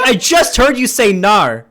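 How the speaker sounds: loud, high mock-outraged protest; retold shout of disbelief; exasperated retort. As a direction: insist at full volume, comically exasperated. Retelling exasperated argument over accent.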